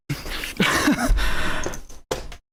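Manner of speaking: breathy, voiceless laugh; real laugh of disbelief; disbelieving laugh. Real, not acted. Disbelieving laugh before swearing.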